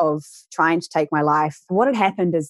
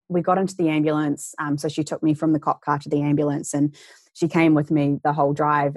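usual speaking voice

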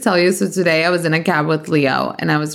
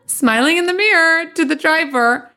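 ordinary talk

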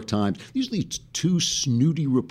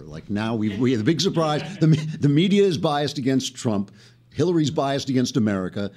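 ordinary talk

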